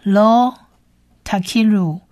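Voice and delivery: Hebrew verse read word by word with long pauses; measured language-teacher model reading; careful recitation. Shown as a demonstration. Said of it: "我们来把这一节经文呢多念几次".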